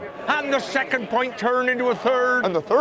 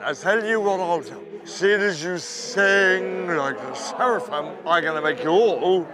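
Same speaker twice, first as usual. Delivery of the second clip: God's voice; 5 semitones lower than usual. Acted. God addresses everybody.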